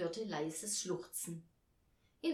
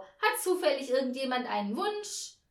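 high, loud question to a crowd; calling out; 6 semitones higher, 8 dB louder than usual. A narrator's reading. Hostess asking all the guests for a wish, calling out. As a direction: call round the room. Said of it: "rief die Frau".

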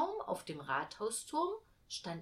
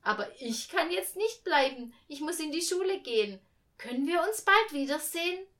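put-on high, raised child's voice; eager little girl; 8 semitones higher, 6 dB louder than usual. A narrator's reading. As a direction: chatter up eagerly at the angel. Millie takes leave of angel.

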